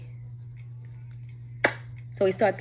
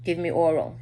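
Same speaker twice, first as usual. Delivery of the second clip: ordinary talk, nothing marked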